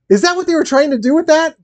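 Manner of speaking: floored by a sudden realization; shocked gasp. Real, not acted. Streamer realizes the movie's plot, gasps in shock.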